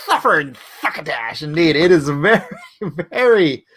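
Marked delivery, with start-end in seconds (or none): 0.0-1.4 lisping cartoon cat voice; spray the S sounds like Sylvester; a light Sylvester lisp.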